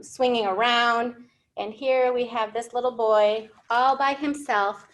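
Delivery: ordinary talk, nothing marked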